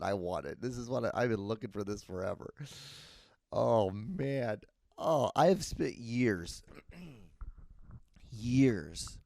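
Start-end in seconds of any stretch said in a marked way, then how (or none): none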